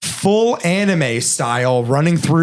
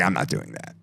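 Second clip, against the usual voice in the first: low, quiet, unbudging star refusal; Jack Nicholson; 9 semitones lower, 10 dB quieter than usual. Retold or imitated. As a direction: drop low and soft to refuse. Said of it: "And he's like".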